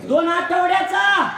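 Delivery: loud call. Real, not acted. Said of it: "मोठ्याने बोला".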